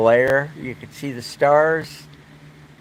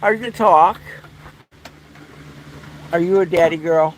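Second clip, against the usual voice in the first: pet talk; 5 semitones higher, faster than usual. Real, not acted. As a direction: coo at the dog, teasing. Talking to the dog Dakota.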